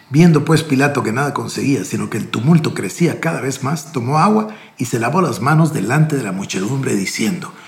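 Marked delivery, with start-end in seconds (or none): none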